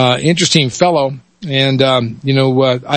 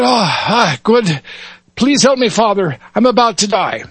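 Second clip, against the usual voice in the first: high, pleading Irish brogue; Irish lad; 8 semitones higher than usual. Acted. Dying lad begs the father for help, Irish accent. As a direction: beg weakly in Irish lilt. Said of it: "switch between dialects here".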